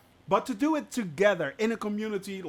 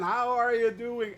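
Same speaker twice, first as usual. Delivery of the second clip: normal talking voice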